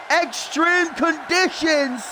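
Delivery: slow, drawn-out ring announcement, pitched high; winner announcement. Real, not acted.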